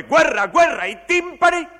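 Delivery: very high, full-force theatrical shout; war cry. Acted. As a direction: shout it out like pounding drums. War climax of the recitation. (grido di guerra a piena voce)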